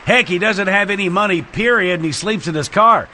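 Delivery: big, high showbiz emcee intro; club announcer. Acted. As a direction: hype up the next act like a club emcee.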